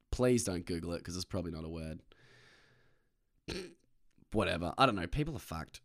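usual speaking voice

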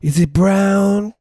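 high-pitched Cleveland burnout guy accent. Shown as a demonstration. Demonstrating the strange accent to a friend, high Cleveland burnout voice. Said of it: "And it's like".

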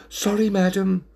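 small, high-pitched apology; flustered waiter. A narrator's reading. Flustered waiter apologises to a lady, high-voiced. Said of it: "Starting to get into a state".

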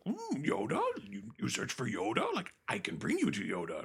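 croaky little green creature voice, starting high; Yoda. Retold or imitated. Little green guy offering Luke help, quirky creature voice. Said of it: "And he's like".

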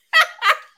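sharp high burst of laughter. Real, not acted. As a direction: laugh out loud. Laughing at the exchange.

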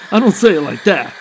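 laughing. Real, not acted.

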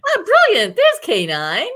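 high, loud and thrilled; light up with delight. Real, not acted. Delighted at seeing toy K9.

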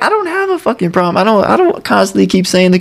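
high-pitched, defensive protest; mock indignant denial. Retelling the villain denying his habit, mock indignant.

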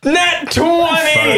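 high, slow triumphant call. Real, not acted. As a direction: crow over the dice roll. Player celebrates a roll.

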